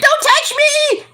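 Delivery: high, slow, shrill-pitched mock wail; mock dramatic Carmella. Host mimics Carmella crying in the ring, high and slow. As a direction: ham up the diva pushing him away. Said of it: "she's like".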